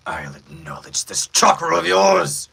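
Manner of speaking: slow, loud villain declaration. Shown as a demonstration. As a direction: draw it out in a heavier villain voice. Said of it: "you guys wanna hear it deeper".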